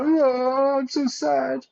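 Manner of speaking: very high, hammed-up wail; mock crying. Mocking someone crying on prime minister's shoulder, mock crying. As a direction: wail it in a squeaky pretend sob. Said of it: "so you could cry on his shoulder".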